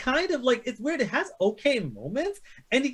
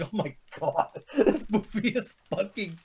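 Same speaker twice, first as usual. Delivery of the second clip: laughing disbelief; 3 semitones lower, 5 dB quieter than usual. Retold or imitated. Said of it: "I'm like".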